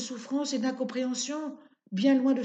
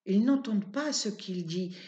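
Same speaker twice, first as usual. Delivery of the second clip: usual speaking voice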